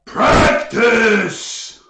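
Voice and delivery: loud, high-pitched ogre bellow; ogre roar. Acted. Game master as ogre charging at player, roaring. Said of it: "he says".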